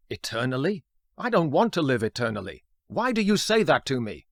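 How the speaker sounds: startled, building to a loud, high-pitched explosion; startled outburst. Acted. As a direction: act out an indignant outburst, rising in pitch and volume. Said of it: "he explodes at Kuznetsov".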